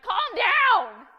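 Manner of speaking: high, full-volume acted shout; parent's yell. Parent at three-year-old mid-tantrum, exasperated yell. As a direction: yell it sharply at the top of your voice. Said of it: "let alone yell at them".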